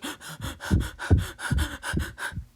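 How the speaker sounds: rapid panting breaths, demonstrating; hyperventilating gasps. Shown as a demonstration. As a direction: show fast gasping breaths. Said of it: "So they're going like".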